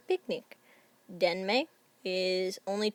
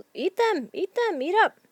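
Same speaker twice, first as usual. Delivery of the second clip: much higher, pushy coaxing; urging relative; 10 semitones higher, 6 dB louder than usual. Retold or imitated. Relative urging guests to eat leftover sandwiches, eager coaxing. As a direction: press the food on everyone, eager and insistent. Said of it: "your family member is like".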